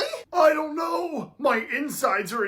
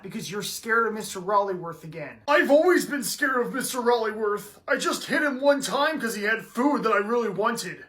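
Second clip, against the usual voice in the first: normal talking voice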